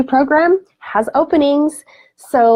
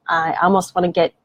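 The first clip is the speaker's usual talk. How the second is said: ordinary talk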